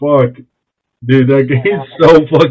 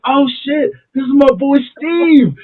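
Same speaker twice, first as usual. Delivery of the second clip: high-pitched retold greeting; mock delighted surprise; 10 semitones higher than usual. Introducing his boy Steve, mock excited surprise. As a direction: act out happily spotting a friend, pitched up.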